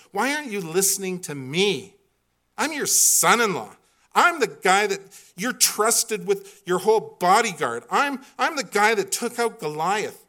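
animated, insistent pleading of a case; arguing like a lawyer. Acted. David argues his case to Saul, arguing like a lawyer. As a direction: argue your defence point by point, pushing hard. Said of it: "starts to argue like a Philadelphia lawyer".

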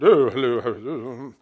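lightly voiced snooty greeting; arrogant Fred. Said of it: "arrogant attitude".

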